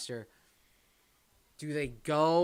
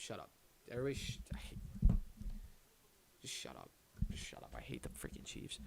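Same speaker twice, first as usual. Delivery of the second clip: whispered gripe; 7 dB quieter, faster than usual. Real, not acted. Annoyed at chat about Chiefs.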